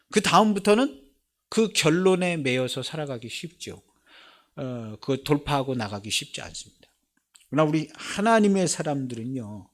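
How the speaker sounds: ordinary talk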